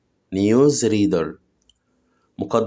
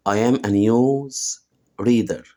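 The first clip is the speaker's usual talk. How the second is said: slow model sentence; much slower than usual. Shown as a demonstration. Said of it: "teacher models slowly".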